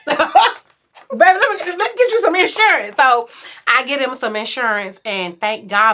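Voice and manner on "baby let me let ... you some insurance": high, fast coaxing with laugh. Retold or imitated. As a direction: sweet-talk him into it. Sweet-talking husband about insurance.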